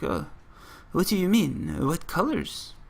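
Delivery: bad French accent. French newsstand seller baffled by the colour question, bad French accent. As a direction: attempt a clumsy French accent. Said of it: "trying to do a French accent horribly".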